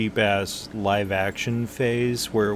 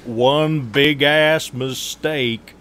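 slow, louder mock Southern drawl; Southern accent; 5 semitones higher, 6 dB louder, much slower than usual. Friend jokingly imitates an Idaho local, in a slow, loud Southern accent. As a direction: put on a Southern accent, dragging it slowly. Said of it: "that sounds Southern to me".

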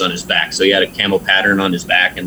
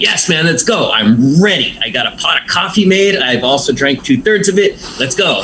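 retold jittery eagerness, higher pitched; over-caffeinated hype; 6 semitones higher than usual. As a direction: act out being wired and raring to go. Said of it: "I'm, like, vibrating".

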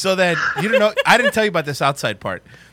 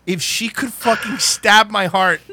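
ordinary talk

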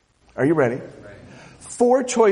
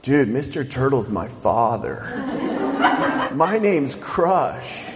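Crush the turtle; 6 semitones lower than usual. Retold or imitated. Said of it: "Finding Nemo quote".

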